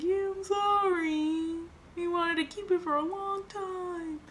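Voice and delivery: high, drawn-out tearful apology; mock crying. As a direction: whine it like a sobbing kid. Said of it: "I'm just crying and crying".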